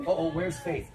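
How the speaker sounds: ordinary talk, nothing marked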